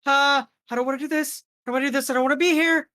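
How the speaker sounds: very high, loud frightened whimpering; scared pleading. Acted. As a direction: squeal it in rising panic. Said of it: "she's like".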